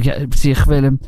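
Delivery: plain reading voice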